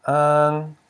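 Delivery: ang held long and loud; stressed ang ending. Shown as a demonstration. Teacher isolates the live syllable, ang sounded out. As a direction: lean on the nasal ang. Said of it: "live syllable".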